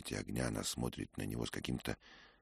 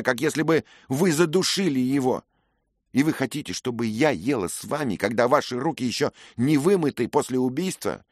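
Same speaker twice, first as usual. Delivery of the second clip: higher, louder accusing rant; furious young lady; 13 semitones higher, 13 dB louder than usual. A narrator's reading. Grieving lady accusing Dick of killing her kinsman, furious. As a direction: hurl every accusation at the knight. The lady's tirade continues.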